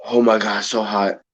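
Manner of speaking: low, pained groaning from a burning mouth; suffering through the heat of the chip; tormented by the heat. Real, not acted. Eater moaning to the stream about the burn, low pained voice. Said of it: "You're tormented".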